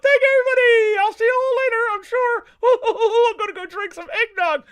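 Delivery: high puppet goodbye with a giggle; Mister Rob signing off. Acted. Mister Rob says goodbye to everybody before leaving, in a high puppet voice with a giggle. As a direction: wave the crowd off as the silly puppet.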